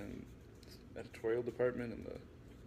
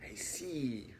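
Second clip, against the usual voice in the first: hissed whispering henchman; Igor whisper; 3 semitones higher than usual. Acted. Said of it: "he hissed like a little Igor".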